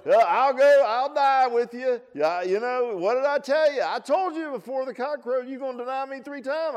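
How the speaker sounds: very high, loud teasing scold; mock scolding Jesus. Jesus scolding proud Peter, very high and loud. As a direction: rub it in, hammed up.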